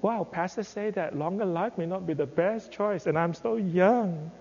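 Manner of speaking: put-on young listener's worry. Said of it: "I see the young people sitting there".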